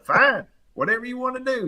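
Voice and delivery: high-pitched, put-on huffy surrender; salty. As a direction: imitate a cousin grudgingly giving in. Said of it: "he's salty".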